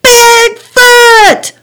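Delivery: very high, loud drawn-out yell; all-out yell. A narrator's reading. Fuming girl summoning Bigfoot, yelling. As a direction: bellow it with all your might. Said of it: "with all her might, she yelled".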